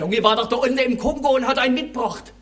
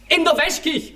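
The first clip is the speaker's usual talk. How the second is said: indignant disbelief; 7 semitones higher than usual. Retold or imitated.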